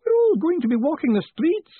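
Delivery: high, loud wail of doom; despairing. Acted. Clerk fearing ruin, wailing loudly to Moist. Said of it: "drain the bitter cup of despair".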